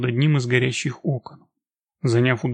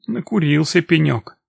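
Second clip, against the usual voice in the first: ordinary delivery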